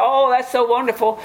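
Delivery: high, put-on admiring coo; mock gushing. Listeners praising someone's talk about Jesus, mock gushing. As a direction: imitate people gushing over pious talk.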